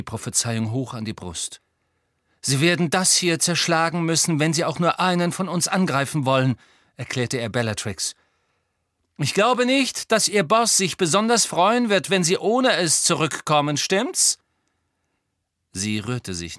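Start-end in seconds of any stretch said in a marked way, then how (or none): 2.4-6.6 raised, louder, firm challenge; Harry standing his ground. A narrator's reading. 9.2-14.4 high, loud, challenging taunt; defiant Harry. A narrator's reading.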